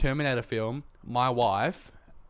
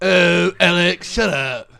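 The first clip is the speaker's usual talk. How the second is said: mock American voice; 4 semitones higher, 10 dB louder, slower than usual. Mock reply in accent.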